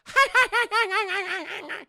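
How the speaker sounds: high, yapping chihuahua cackle; Dane DeHaan. Acted. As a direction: laugh like a chihuahua barking at you. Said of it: "he sounds like a chihuahua".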